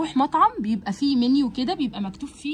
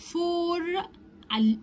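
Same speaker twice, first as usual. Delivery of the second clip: normal talking voice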